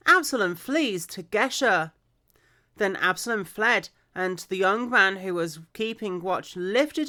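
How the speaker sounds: clear, projected story reading; scripture read-aloud. Acted. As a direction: read the Bible story out with expression.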